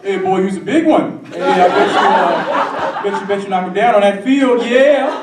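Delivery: loud, hollered mock coach voice; catcalling football coach. Football coach catcalls a boy, loud and hyped. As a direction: holler it, climbing to a high "yeah". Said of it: "would get catcalled by football coaches".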